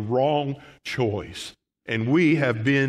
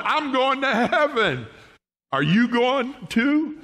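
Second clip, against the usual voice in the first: higher, bubbling with a laugh; joyful answer; 9 semitones higher than usual. Acted. Believer answering the preacher's greeting, high laughing joy. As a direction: burst out with glee. Acted happy believer reply.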